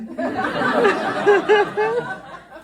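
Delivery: big high laughing fit; laughing loudly, can't stop; long loud laugh. Real, not acted. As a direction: crack up at your own joke. Laughs at the Dutch joke.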